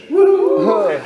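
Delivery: high delighted whoop with a laugh; happy little yelp; excited whoop. Real, not acted. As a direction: cheer the win. Celebrating box office win.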